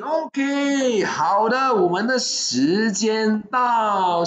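high, drawn-out announcement; calling time. Real, not acted. Host telling viewers the offer is over, calling time. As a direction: stretch it out slowly as time runs out.

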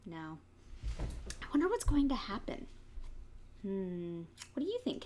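ordinary delivery

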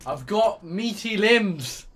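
slow, loud mock-heroic boast; hammed-up boast. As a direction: drag it out, loud and proud. Boastful game line, slow and loud.

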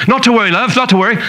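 breezy mock-British reassurance; confident British doctor. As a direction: imitate a British medic reassuring a child. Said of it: "the guy was so confident".

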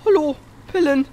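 high, loud demanding call; impatient calling. Real, not acted. Player demanding the pills in a game, impatient call. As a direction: call out sharply, pitched right up. Calls at pills that won't register.

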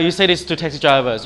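usual speaking voice

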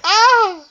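very high, loud "ah"; startled squeal. Acted. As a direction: let out a high yelp. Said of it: "and he's like".

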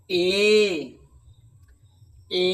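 "এ" held as a long e; long e sound. Shown as a demonstration. Teacher to class, modelling long "এ". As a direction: draw out "এ" evenly. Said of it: "যেমন".

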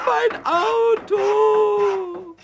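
slow wailing cry over the lost car. Acted. Pumuckl begs Meister Eder, wailing. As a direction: sob out the words. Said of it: "wütend und fast weinend".

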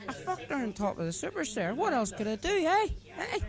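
put-on leering drunk Paddy. Paddy justifying himself to his friend, high leering bluster. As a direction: sell it as the most natural thing.

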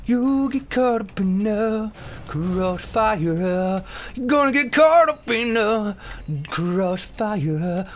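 slow exaggerated mocking repeat, rising to a high squeal; mocking drawn-out crossfire. Gamer mocking the NPC's line, slow and sing-song. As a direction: parrot the NPC's word back, stretching it out. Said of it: "what was that you said?".

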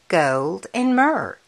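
plain reading voice

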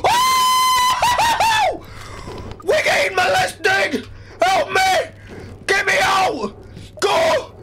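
high, loud terrified cries; panicked screaming. Real, not acted. Running towards the monster on a dare, screaming. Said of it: "there's my dare".

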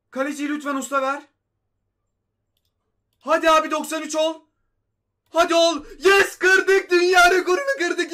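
real euphoric yelling, voice cracking at the peak; ecstatic shouting. Real, not acted. Gamer shouting to viewers as the world record falls, ecstatic.

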